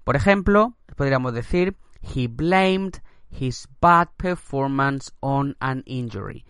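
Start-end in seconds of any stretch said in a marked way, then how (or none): 2.0-6.5 slow English read; slow model sentence. Shown as a demonstration. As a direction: read it slowly for learners. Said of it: "teacher models example".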